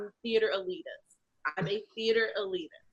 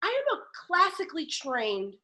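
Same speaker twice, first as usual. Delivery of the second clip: higher, lofty insistence on being serious; indignant protest; 7 semitones higher than usual. Retold or imitated. To the director, a higher haughty classically trained protest. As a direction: draw yourself up and insist. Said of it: "I was like".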